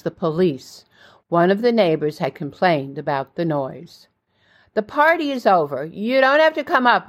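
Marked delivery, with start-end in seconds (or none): 4.7-7.1 narrated scream, kept in check; mild scream. A narrator's reading. Hostess calls back to police over the intercom, mild scream. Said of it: "I screamed back into the intercom".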